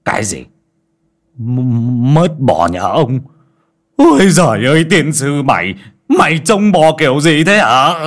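enraged outburst. Acted. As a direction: explode at the news in rage. Master learns cow lost.

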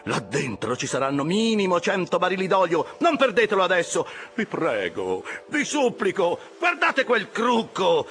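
high, frantic begging of the crew; excited, pleading cries. A narrator's reading. Officer hopping about, begging his rowers to speed up, crying high. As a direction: implore them, pitch leaping up on each cry. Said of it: "gridava Flask saltando su e giù".

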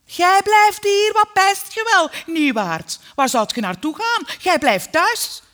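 very loud, high-pitched yelling; shrieked command, stretched for force; mother calling out. A narrator's reading. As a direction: shout orders like a domineering mother. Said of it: "riep mijn moeder".

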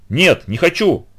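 high, insistent protest; alarmed refusal. A narrator's reading. Frightened boy rejects the horse's suggestion, alarmed refusal. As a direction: refuse at once, upset.

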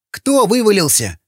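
high, confused shout of a different character; shouting from below. A narrator's reading. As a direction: call up from below, puzzled and high. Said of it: "закричал снизу Сквернослов".

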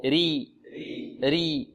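ري with a light, thin r. Shown as a demonstration. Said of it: "انطقوا معي".

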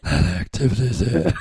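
very low man voice. Acted.

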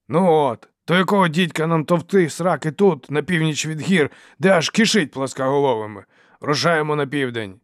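loud, high-pitched exasperated tirade; angry rant. A narrator's reading. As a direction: harangue them and demand agreement. Acted quarrel, Dow ranting. (сердита, гучна тирада)

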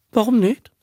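hohe, unschuldige Frage; unschuldig. A narrator's reading. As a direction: frag ganz naiv und unschuldig. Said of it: "fragte der Darling unschuldig". (der Darling fragt Abe unschuldig, hoch)

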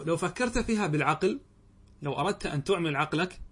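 normal talking voice

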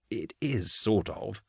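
very soft, unsure admission; hesitant stammer. A narrator's reading. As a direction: back down quietly and uneasily. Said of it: "Carl stammered".

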